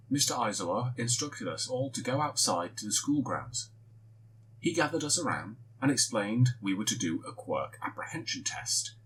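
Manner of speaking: ordinary delivery, nothing marked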